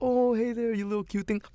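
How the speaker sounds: put-on high cooing baby talk. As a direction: coo at a cute puppy. Cooing at a puppy.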